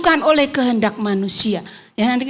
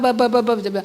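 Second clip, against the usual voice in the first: normal talking voice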